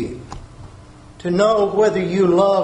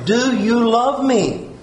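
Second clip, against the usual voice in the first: ordinary talk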